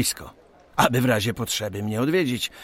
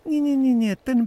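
high, brisk no-no-no correcting them; quick correction; 14 semitones higher, 3 dB louder than usual. Acted. President correcting the surveyors, brisk and high. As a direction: cut them off, pointing the right house. Correcting the men's wrong guess.

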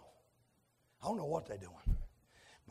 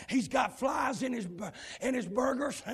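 ordinary talk, nothing marked

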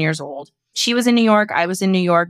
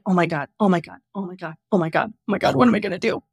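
mock panic; 2 semitones lower, faster than usual. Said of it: "I'm deer in headlights".

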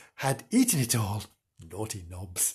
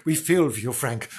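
ordinary delivery

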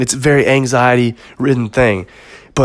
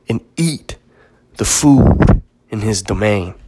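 lightly imitating a Christopher Walken movie speech; dramatic retold movie story; 2 semitones lower than usual. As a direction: spin the lion tale like a brooding monologue. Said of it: "This is what he says".

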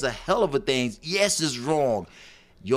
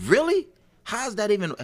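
high, loud disbelieving squeak; incredulous really; 10 semitones higher, 4 dB louder than usual. Real, not acted. Host reacting to listener letter, stunned disbelief. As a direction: shoot the word up in shock.

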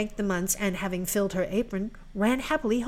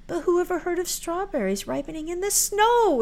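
exclaiming; 10 semitones higher, 3 dB louder than usual. A narrator's reading. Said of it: "exclaimed Marouckla".

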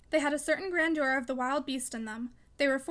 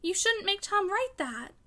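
high, slow, frightened plea; choked with fear; 6 semitones higher, slower than usual. A narrator's reading. Daughter begging father to spare Tom, fearful. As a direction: implore him, drawing the words out. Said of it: "almost choked with fear".

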